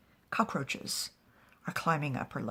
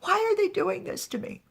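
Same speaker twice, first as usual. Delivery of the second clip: high, loud acted wail; bursting into tears; 13 semitones higher, 7 dB louder, faster than usual. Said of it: "bursts into tears".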